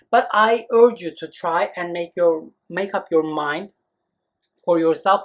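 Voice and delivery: ordinary talk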